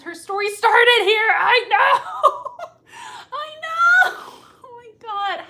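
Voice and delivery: high, loud, laughing and near tears; overwhelmed squeal of emotion; laugh-crying with delight. Real, not acted. Emotional over fan comment.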